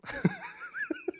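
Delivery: sudden high squeaky giggle; high laugh. Real, not acted. Streamer cracking up at girlfriend's mistake, high giggle. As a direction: let a helpless high laugh slip out.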